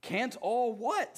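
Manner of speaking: high, slow puzzled bear; curious Pooh. Acted. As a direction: ask it innocently. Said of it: "said Pooh".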